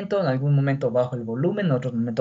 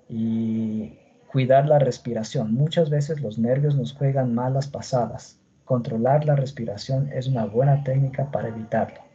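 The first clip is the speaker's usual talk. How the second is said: low, dull, unmodulated reading; deliberately boring lecture voice, low and droning; boring monotone demo; 4 semitones lower than usual. Shown as a demonstration. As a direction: read it as tediously as possible, pitched low. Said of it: "Qué tal si yo diese todita la clase con esa voz".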